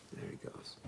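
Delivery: hushed aside; whisper. Shown as a demonstration. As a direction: breathe it out softly. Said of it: "unvoiced aside to listeners".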